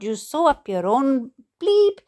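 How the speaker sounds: normal talking voice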